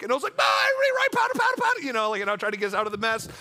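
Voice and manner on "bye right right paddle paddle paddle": high, frantic paddling commands; panicked yelling. Retold or imitated. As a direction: yell in a comic rush of panic. Retold panicked dodge of a rock.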